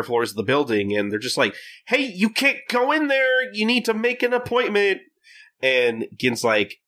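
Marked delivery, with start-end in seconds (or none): 1.9-5.0 calling out. Acted. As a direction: shout it down the hall at someone leaving. Receptionists calling after Gin.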